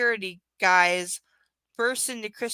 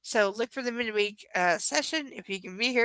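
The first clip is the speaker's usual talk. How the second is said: usual speaking voice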